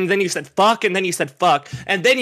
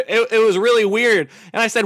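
ordinary talk, nothing marked

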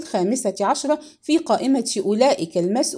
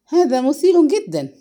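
higher, louder amused reaction; mock excitement; 5 semitones higher, 5 dB louder than usual. A narrator's reading. Young man hearing of a body at Bantry's, irreverently excited. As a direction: react with lively, irreverent interest.

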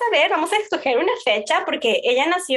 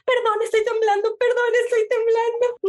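nervous apology; 10 semitones higher than usual. Retold or imitated. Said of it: "porque yo todavía era".

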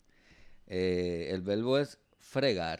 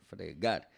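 "Fregar" stressed on the last syllable, -gar; final-syllable stress; 4 semitones lower than usual. Shown as a demonstration. Teacher showing where the force falls, last-syllable stress on "Fregar". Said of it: "la fuerza está en la última sílaba".

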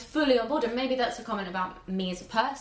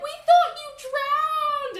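much higher, louder, slow cry; relieved exclamation; 8 dB louder, much slower than usual. Retold or imitated. Family telling uncle they feared him drowned, high cry.

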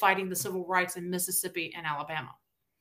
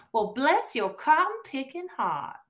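slow, raised, syrupy Southern condescension; polite Southern insult; 4 semitones higher, slower than usual. Acted. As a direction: sweetly insult them in a Southern drawl. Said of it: "We're very polite about it".

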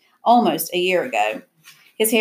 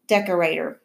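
usual speaking voice

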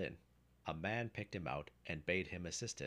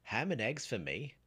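high, loud indignant exclamation; scornful exclamation from a customer; exclaiming; 5 semitones higher, 6 dB louder than usual. A narrator's reading. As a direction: throw the offer back, voice raised. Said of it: "exclaimed Jack".

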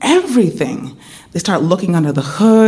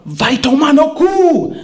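slow, higher Brazilian cuss; teaching a stadium curse, mock yell; 8 semitones higher, slower than usual. Shown as a demonstration. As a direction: belt the Portuguese curse out slow. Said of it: "You're gonna yell out".

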